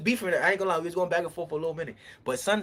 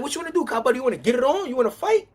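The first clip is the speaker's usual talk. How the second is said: higher, heated dare; challenging to fight; 7 semitones higher, 4 dB louder, faster than usual. Retold or imitated. To Cobb Butter in the standoff, a heated, higher dare to fight. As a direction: push him to fight.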